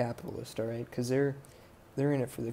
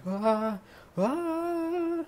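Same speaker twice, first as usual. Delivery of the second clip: two high panicked screaming ahs, much higher and louder; panicked screams; 4 dB louder than usual. Retold or imitated. Boyfriend sees giant spiders coming, high panicked screams. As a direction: shriek in terror at the spiders. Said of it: "he runs screaming".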